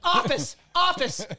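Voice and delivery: high mock teacher voice; teacher ordering. Teacher sends the kid to the office, high mock order. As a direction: pitch it up and send him off. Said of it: "And they're like".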